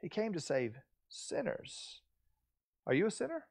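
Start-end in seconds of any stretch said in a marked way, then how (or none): 0.0-2.0 soft, dawning understanding, pausing before "sinners"; much softer, as if just getting it; hushed realization. Acted. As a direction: let it sink in quietly. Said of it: "you go, Oh".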